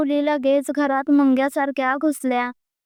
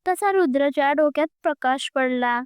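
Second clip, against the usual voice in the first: usual speaking voice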